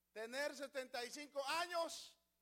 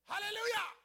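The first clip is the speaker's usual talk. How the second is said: high, loud exultant cry; loud jubilant alleluia; shouted hallelujah; 8 semitones higher, 6 dB louder than usual. Real, not acted. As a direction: burst out in praise. Preacher's climactic shout.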